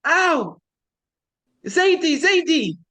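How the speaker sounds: put-on high child's yelp of pain, calling out; child's cry for grandpa. Little boy screams for his grandfather, mock pained high cry. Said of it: "screams out".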